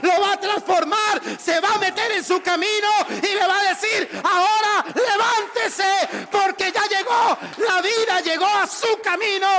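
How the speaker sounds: high, loud shouted proclamation; full-force preaching. Real, not acted. Preacher declaring God will change your course, shouted proclamation.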